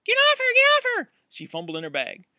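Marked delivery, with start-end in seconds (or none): 0.0-1.1 high, very loud scream of a command. Acted. Startled roommate screaming at Roy on the floor, full scream. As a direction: scream it to stop an attacker. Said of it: "she screamed".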